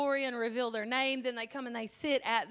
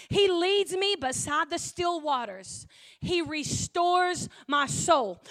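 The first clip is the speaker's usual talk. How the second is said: slow, louder, measured reading of the psalm; solemn scripture reading; 2 semitones higher, 5 dB louder, slower than usual. Acted. Preacher reading Psalm twenty-three to the church, slow and solemn. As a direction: read each line slowly and reverently.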